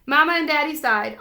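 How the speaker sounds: usual speaking voice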